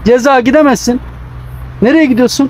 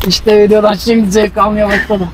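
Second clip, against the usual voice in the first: slow words through laughter; laughing. Real, not acted. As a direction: laugh as you speak. Says while laughing.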